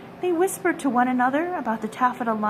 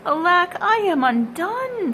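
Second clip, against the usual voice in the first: high, louder despairing cry; old tailor giving up, despairing; sad lament; 7 semitones higher, 4 dB louder than usual. A narrator's reading. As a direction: wail it out, ruined. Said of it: "went sadly to bed".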